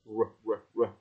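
"R" with pursed lips and curled tongue; rounded American R. Shown as a demonstration. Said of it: "chu môi ra kiểu hình âm U căng ấy". (âm R kiểu Mỹ, chu môi)

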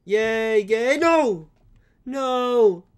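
loud, high cries of yay, then no; cheer, then yell in dismay; cheer turning to dismay. Real, not acted. Gamer dying mid-celebration, shouting in dismay.

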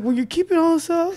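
very high, squeaky protest; confidence crumbling. Acted. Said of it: "my confidence level started going lower".

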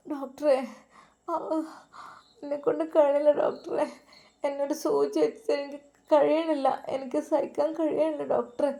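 high, strained voice of a woman in labour, close to crying; tearful pleading. A narrator's reading. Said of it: "അവൾ കരഞ്ഞുകൊണ്ടിരിക്കുകയാണ്".

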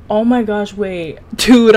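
ordinary talk

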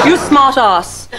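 "you smart ass" slowly, with an English long "ah" in ass; British pronunciation. Shown as a demonstration. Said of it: "And I say".

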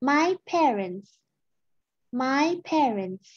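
"my parents" very slow, final s clear; final s sounded. Shown as a demonstration. Teacher shows the plural ending: slow and clear. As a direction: drag out "my parents" and hiss the s. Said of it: "พ่อกับแม่เนาะ".

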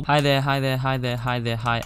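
ordinary talk

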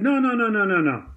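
string of no's starting high and dropping; mock emphatic refusal; retold firm refusal. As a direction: imitate waving off a request, high at first.

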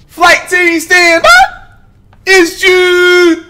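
high, loud hype call to the crew; hype shout. Real, not acted. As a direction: shout the intro like a hype man. Streamer's hype intro catchphrase.

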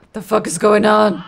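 loud, exasperated yell; raging gamer. Real, not acted. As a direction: shout at the chaos on screen. Chaotic fight with enemies.

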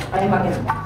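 usual speaking voice

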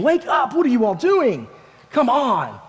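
high-pitched, exasperated calling out; exasperated wake-up call. Acted. Jesus finds his disciples asleep again, exasperated. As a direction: rouse sleepers with an acted, impatient cry.

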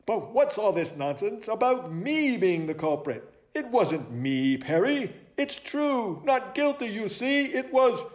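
high-pitched protest; huffy, high self-defence; indignant owl detective. Acted. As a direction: deny the charge in a high owl voice. Owl protests his innocence.

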